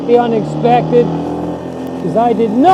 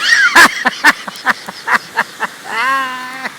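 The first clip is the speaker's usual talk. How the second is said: sheepish laugh; 2 semitones higher than usual. Real, not acted. Sheepish laugh after joke.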